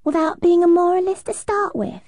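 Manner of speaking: put-on cheeky Cockney accent; pert Cockney accent. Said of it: "with a pert Cockney accent".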